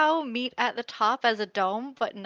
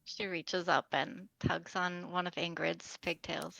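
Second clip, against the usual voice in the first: normal talking voice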